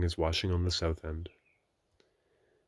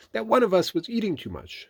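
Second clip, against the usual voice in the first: Rabbit; 14 semitones higher, 7 dB louder than usual. A narrator's reading. Rabbit voiced in Pooh story.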